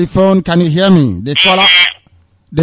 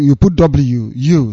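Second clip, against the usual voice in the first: ordinary delivery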